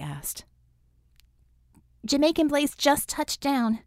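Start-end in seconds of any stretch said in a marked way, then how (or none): 2.0-3.8 excited report. Acted. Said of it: "Bella reported".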